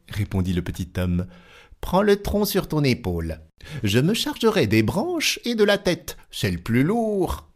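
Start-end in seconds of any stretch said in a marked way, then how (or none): 1.8-7.5 high, bossy little tailor; crafty tailor. Acted. Tailor giving the giant orders, high and perky. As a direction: direct him cheerfully, up high.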